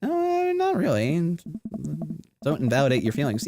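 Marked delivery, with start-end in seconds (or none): none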